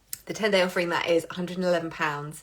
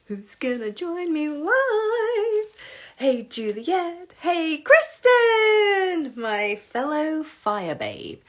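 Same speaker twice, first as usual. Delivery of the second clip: sing-song greeting; 9 semitones higher, much slower than usual. Real, not acted. Greeting live viewers by name.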